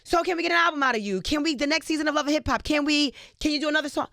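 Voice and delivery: eager request, imitated. Producers ask singer for an album, imitated eager request.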